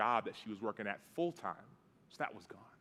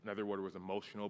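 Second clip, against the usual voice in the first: ordinary delivery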